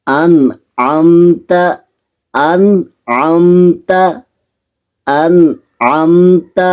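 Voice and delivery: in انعمت das Ain deutlich aus der Kehle sprechen; انعمت mit hörbarem Ain, nicht Hamza; klares Ain. Shown as a demonstration. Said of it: "حمزہ کو عین پڑھ لیتے ہیں".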